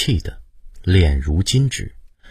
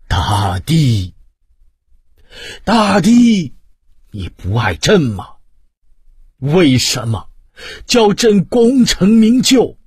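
much higher and louder, slow, despairing wails; anguished cries to heaven; 9 semitones higher, 5 dB louder, slower than usual. A narrator's reading. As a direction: howl your grief up at the sky. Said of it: "仰天连叫".

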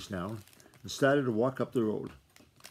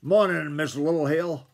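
loud, raised-pitch hail; bellowing; 4 semitones higher, 7 dB louder than usual. A narrator's reading. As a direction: bellow a greeting down the road. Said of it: "Then he bellowed".

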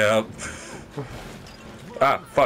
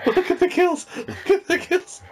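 very high, squeaking through laughter; helpless laughter. Real, not acted. Friend mocking the score screen, high helpless laughter. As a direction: squeal the words while cracking up.

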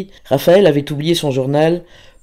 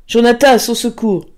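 high, urgent call; cry for help; 9 semitones higher, 4 dB louder than usual. A narrator's reading. Pauline summoning help for Raphaël, alarmed cry. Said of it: "cria-t-elle".

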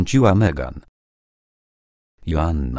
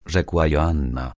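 ordinary delivery, nothing marked

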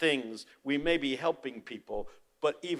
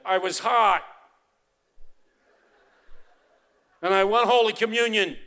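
loud demand; 9 semitones higher, 10 dB louder than usual. Retold or imitated. Dying man demanding communion.

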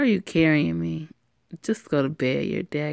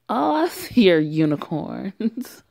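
put-on drunk; 4 semitones higher, 5 dB louder, slower than usual. Drunk girl at party.

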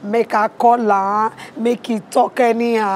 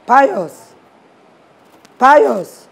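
raised voice calling a name; 2 semitones higher, 6 dB louder than usual. Acted. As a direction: call for someone not answering. Calling out, no answer.

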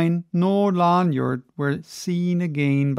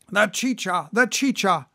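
calling for drink; 2 semitones higher than usual. Acted. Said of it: "cried Don Pedro".

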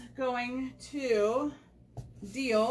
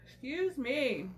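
ordinary delivery

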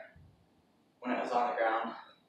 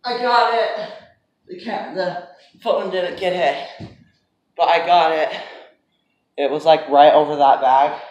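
loud and triumphant; excited shout; 3 semitones higher, 10 dB louder than usual. Real, not acted. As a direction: celebrate the catch loudly. Celebrating a catch.

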